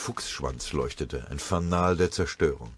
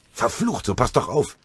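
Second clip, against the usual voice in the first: gereizter Ausruf; 6 semitones higher than usual. Acted. Tinnes angerempelt im Gebüsch.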